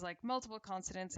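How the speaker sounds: ordinary talk, nothing marked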